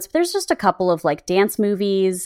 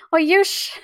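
[name] voice; 11 semitones higher than usual. Retold or imitated.

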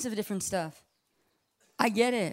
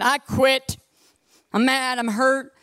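loud, higher-pitched quitting announcement; fed-up outburst; 5 semitones higher, 8 dB louder, slower than usual. Retold or imitated. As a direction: act out storming in to quit. Acting out walking in to quit.

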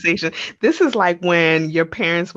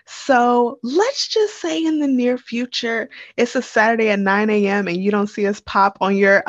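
ordinary talk, nothing marked

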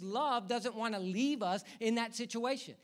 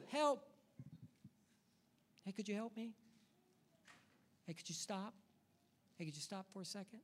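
very soft, casual polite request; half-hearted, hushed plea; meek call for help; 10 dB quieter than usual. Acted. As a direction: ask for rescue as if not wanting to bother anyone. Acting out a timid drowning person.

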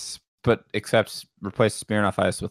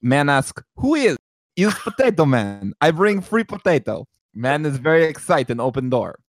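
louder mock Eastern European joke-telling; Eastern European accent; 4 semitones higher, 4 dB louder than usual. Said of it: "I'll do it with the joke".

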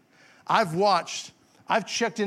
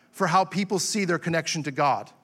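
usual speaking voice

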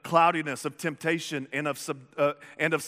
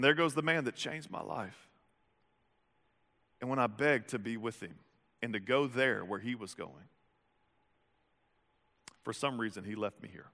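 wistful; 4 semitones lower, 6 dB quieter than usual. Retold or imitated. Man's inner thought, fading.

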